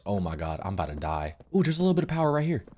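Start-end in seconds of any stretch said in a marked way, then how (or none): 0.0-1.3 low, soft and scared; scared whisper-soft. Real, not acted. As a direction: mutter it in fear. Scared in dark.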